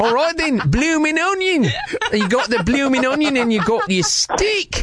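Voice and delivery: put-on laughing through an Aussie accent; hammy Aussie, cracking up; Australian accent. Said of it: "now you sound British to me".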